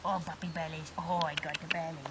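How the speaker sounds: ordinary talk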